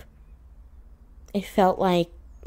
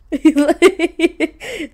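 loud, high-pitched laugh; amused laugh; 10 semitones higher, 8 dB louder than usual. Real, not acted. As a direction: burst out laughing at how bad it would be. Laughs at imagined bad accents.

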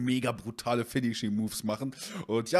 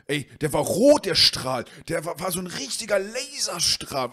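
high, loud and wide-eyed buddy; excited friend; 6 semitones higher, 5 dB louder than usual. Retold or imitated. Friend insisting to the owner about the red beam, high and loud. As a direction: blurt the laser story, pitched up.

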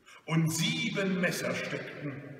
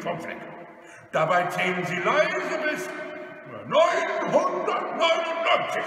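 loud, stern robber instructions; commanding robber; 3 semitones higher, 5 dB louder than usual. Acted. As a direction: build to a high bellow on the number. Robber gives threatening instructions.

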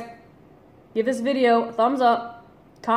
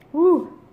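hot exhale; 8 semitones higher, 5 dB louder than usual. Real, not acted. Exhaling from spicy heat.

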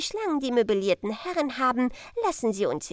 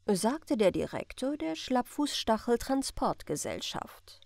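usual speaking voice

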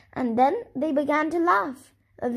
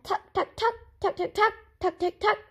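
chicken clucking; 7 semitones higher than usual. A narrator's reading. People repeat chicken sound.

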